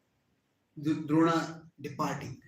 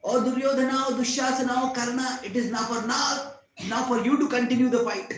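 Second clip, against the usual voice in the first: raised, louder appeal across a battlefield; calling out; 6 semitones higher, 6 dB louder than usual. A narrator's reading. Warrior tells his comrades to fight on, calling out. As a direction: cry out urgently to fellow warriors.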